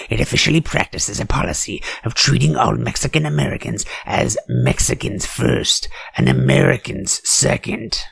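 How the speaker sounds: ordinary delivery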